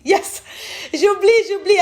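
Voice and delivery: cheer, then laugh it off; a real excited aha. Real, not acted. Catches her own counting mistake.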